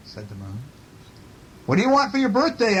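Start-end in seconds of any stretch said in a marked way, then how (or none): 1.7-2.8 high, quick storybook question; calling bear. A narrator's reading. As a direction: call the question up to the moon. Bear calls across to the moon.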